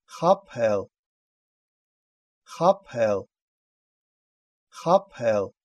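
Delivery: "Хапел" with a puffed "п"; voiceless "п" mid-word. Shown as a demonstration. Teacher giving an example for learners, puffed "п" in "Хапел". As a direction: say "Хапел" distinctly, breath after the p. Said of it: "читается апе".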